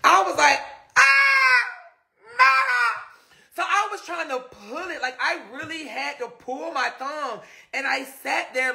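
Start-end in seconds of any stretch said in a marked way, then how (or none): 1.0-3.0 sudden high shocked cry; startled yelp. Retold or imitated. Her thumb locks up, she yelps in shock. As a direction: yelp like your thumb just froze.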